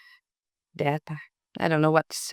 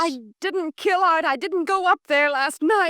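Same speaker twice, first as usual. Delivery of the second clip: desperate denial; 8 semitones higher than usual. Acted. Accused wife denies murder.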